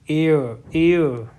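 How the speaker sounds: एयर called out loud, said twice; loud drilled word. Shown as a demonstration. Teacher drilling the class on एयर, loudly. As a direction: drill एयर firmly with silent h. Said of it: "क्या बोलते हैं इसको".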